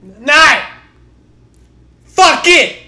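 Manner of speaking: high, loud furious yell; shouting. Real, not acted. Friend on call yelling about losing nine games, high and loud. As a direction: scream it out in disbelief. Cohost exploding at repeated losses.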